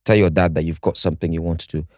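ordinary talk, nothing marked

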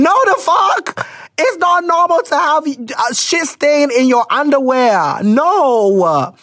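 normal talking voice

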